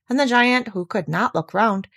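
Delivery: usual speaking voice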